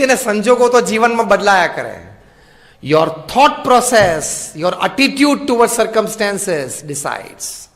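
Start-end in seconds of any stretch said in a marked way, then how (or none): 2.8-7.7 slow emphatic preaching. Acted. Preacher stressing key line.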